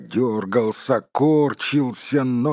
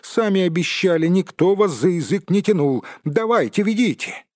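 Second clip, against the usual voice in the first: high, raised, insistent voice; indignant demand; 9 semitones higher, 5 dB louder than usual. A narrator's reading. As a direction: push back hard, pitch well up. Bilbo argues with Gollum. (возмущённо, требовательно)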